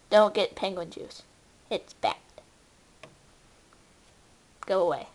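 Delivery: ordinary talk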